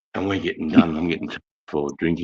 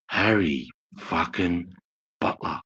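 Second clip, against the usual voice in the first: drawn-out, savouring each word; retold cop's recognition; slower than usual. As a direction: slowly roll out the name, pausing between words. Retold cop recognising an old enemy.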